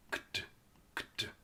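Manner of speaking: "Kt" as two crisp consonants, no vowel; voiceless end sounds. Shown as a demonstration. Teacher isolating the ending of worked, voiceless consonants. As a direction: tap out "kt" softly, no voice. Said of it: "There's another quiet E".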